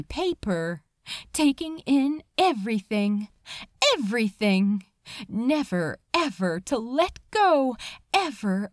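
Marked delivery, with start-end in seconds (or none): none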